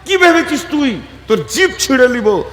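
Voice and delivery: high acted scolding threat; angry imam. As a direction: snap at him like an enraged imam. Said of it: "যে ইমাম সাহেব রেগে গেছে".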